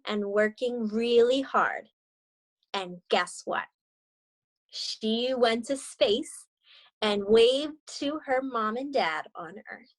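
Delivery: gentle read-aloud storytelling voice; story narration. A narrator's reading. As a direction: read it like a picture book to young students. Teacher reads picture book aloud.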